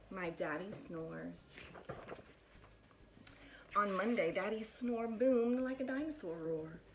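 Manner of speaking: lively, animated picture-book reading; bouncy storytime voice with big swoops; reading to children. Acted. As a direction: read it like storytime for little kids. Teacher reads picture book aloud.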